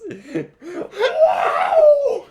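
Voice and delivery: shrieking laugh; loud laughter. Real, not acted. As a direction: howl with laughter.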